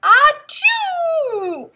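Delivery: big loud mock sneeze; a bear's huge comic sneeze. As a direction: blast out a hammed-up sneeze. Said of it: "the flour made Bear sneeze".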